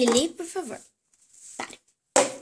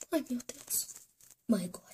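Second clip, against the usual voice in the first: quiet, lower whispered groan; whispered dismay; 4 semitones lower, 12 dB quieter than usual. Acted. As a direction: mutter it to yourself. (sussurro desanimado)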